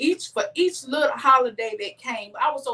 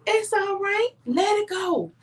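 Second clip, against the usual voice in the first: gently reassure, as the husband does; imitating calm husband; 8 semitones higher than usual. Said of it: "the way I'm telling y'all is the way he said to me".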